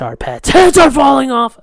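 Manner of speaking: loud, high-pitched outburst; hammed-up exclamation. Doing a bit for the episode, hammed-up cry. Does a quote on purpose.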